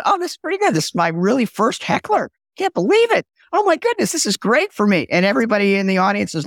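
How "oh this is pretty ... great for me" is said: higher, gleeful inner voice savoring a heckler; thrilled by first heckler. Retold or imitated. Speaker on stage, thrilled by his first heckler, delighted. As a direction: relish the moment like a delighted kid. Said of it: "And I'm, like, feeling".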